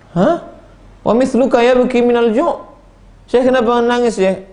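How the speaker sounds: high-pitched, incredulous questioning; acted astonishment. Disciple questions a sheikh weeping from hunger, acted astonishment. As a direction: play the startled disciple, voice jumping up high.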